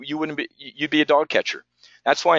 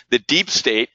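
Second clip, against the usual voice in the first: normal talking voice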